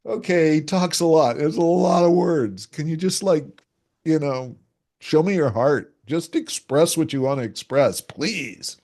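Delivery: fed-up friend asking for heart; impatient listener. Retold or imitated. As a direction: beg him to just get to the feelings. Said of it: "So I have to gush".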